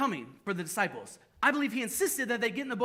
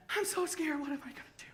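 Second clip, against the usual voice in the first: scared whisper; 7 semitones higher, 4 dB quieter than usual. Retold or imitated. Believer facing new challenge, frightened.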